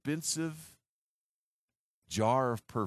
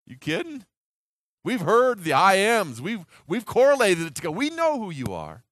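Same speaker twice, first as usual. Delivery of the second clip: eager boast; 6 semitones higher, 8 dB louder than usual. Acted. Disciples voiced boasting to Jesus.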